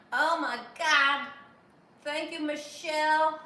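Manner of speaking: loud, slow, drawn-out gush of delight; excited. Real, not acted. Streamer gushing to Michelle about her gift, loud and slow. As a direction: overcome with thanks for the gift. Reacting to a donation.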